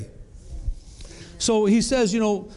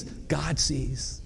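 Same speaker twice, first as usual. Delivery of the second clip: much softer, lower voice; hushed answer; 4 semitones lower, 8 dB quieter than usual. Real, not acted. Preacher answering his own question to the church, hushed. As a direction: drop to a quiet, knowing reply.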